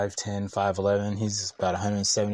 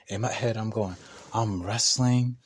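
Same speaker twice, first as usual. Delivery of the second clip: ordinary delivery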